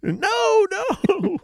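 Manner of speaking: squeaky high protest; panicked no. Retold or imitated. Driver panicking as the car unpairs, high squeak. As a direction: yelp it in alarm.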